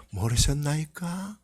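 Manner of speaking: soft, slow gentle question; gently. Shown as a demonstration. Jesus answering his parents softly, slow and gentle. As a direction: ask it gently, drawn out. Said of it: "부드럽게".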